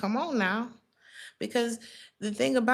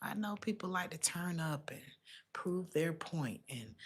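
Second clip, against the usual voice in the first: whisper; 12 dB quieter than usual. Real, not acted. Soothing the querent, drops to whisper.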